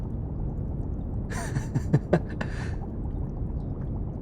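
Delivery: quiet, breathy run of chuckles; soft fond laugh. Acted. Researcher recalling the bodyguard's warnings, soft fond laugh. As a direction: giggle softly at a friend's fussing.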